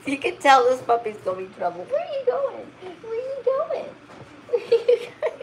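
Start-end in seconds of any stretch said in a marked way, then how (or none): 1.8-5.4 very high sing-song coo at the puppy. Real, not acted. Owner cooing at her wandering husky puppy, very high baby talk.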